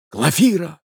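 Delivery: slow, high reproachful cry; exclaimed. Acted. Young man confronting his lady, slow exclaiming cry. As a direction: cry out her name in reproach. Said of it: "вскричал молодой человек".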